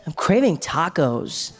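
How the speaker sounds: put-on drawn-out American accent, dramatic; American friend. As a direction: exaggerate it like a laid-back American. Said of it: "my first American friend".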